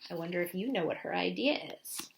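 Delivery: soft aside to listeners. Real, not acted. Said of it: "reader asks listeners".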